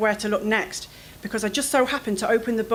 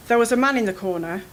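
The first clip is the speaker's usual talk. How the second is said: usual speaking voice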